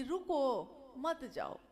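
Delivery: रुको set apart, then मत जाओ together; pause after रुको. Shown as a demonstration. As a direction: break the phrase right after रुको. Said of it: "वाक्य बदल गया तो अर्थ बदला".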